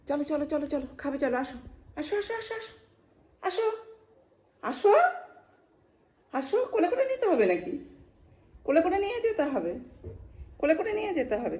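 high pet-calling voice; high coaxing. Real, not acted. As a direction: coax a sulking little one along, pitched right up. Coaxing an angry pet along.

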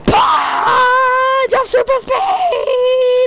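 high, drawn-out power-move shout; battle cry. Pretend. Player calling out a super-speed punch, high drawn-out shout. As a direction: holler it long and high like a superhero attack.

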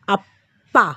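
আপ্পা with a long, held double p; doubled p. Shown as a demonstration. Teacher showing students the doubled p in আপ্পা. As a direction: hold the p in আপ্পা as a doubled sound. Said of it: "পটাকে double করে উচ্চারণ করব".

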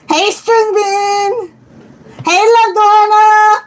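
high, drawn-out hellos; excited greeting. Real, not acted. Streamer greeting viewers as they join, high excited call.

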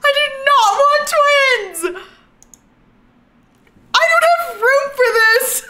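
high, loud half-laughing wail; anguished shriek. Real, not acted. Player wailing at the game over surprise twins. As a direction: wail in mock despair.

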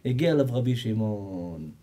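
ordinary talk

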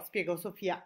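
ordinary delivery, nothing marked

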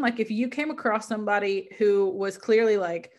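ordinary delivery

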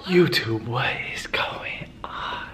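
loud whisper to the camera; hushed but punchy intro whisper; whisper yelling. Real, not acted. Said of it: "Why are you whispering".

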